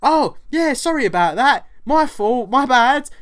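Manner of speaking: high, loud sarcastic apology; mock apology. Shop worker fakes an apology to the customer, high and loud. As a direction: gush a fake sorry. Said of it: "I was like".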